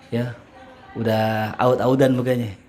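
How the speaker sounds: ordinary delivery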